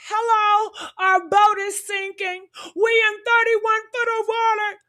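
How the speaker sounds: high, put-on voice of a calm caller; imitating Warren's call. Mocking Warren's call to the tow service in a high voice. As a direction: mimic the call without real panic.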